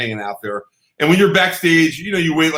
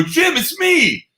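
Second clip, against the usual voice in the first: high, drawn-out call; much slower than usual. Retold or imitated. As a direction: re-enact hailing someone across the room. Calling out to Jim backstage.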